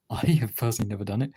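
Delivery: ordinary delivery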